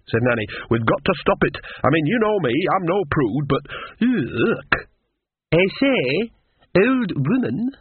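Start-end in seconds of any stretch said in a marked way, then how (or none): 0.7-4.7 higher, urgent character voice ending in disgust; protest, then recoil in disgust; Nanny figure, revolted. Acted. 5.5-7.7 high-pitched, imperious society woman; haughty lady. A narrator's reading. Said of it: "peering haughtily".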